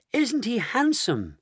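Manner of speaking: high, slow admiring question; marvelling. Acted. Daughter admiring her younger father, marvelling. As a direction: coo it high and drawn out.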